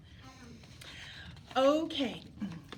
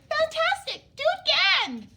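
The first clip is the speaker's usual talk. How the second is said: much higher, louder cheering shout; 10 dB louder than usual. A narrator's reading. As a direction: egg him on with a shout. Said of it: "Elizabeth shouted".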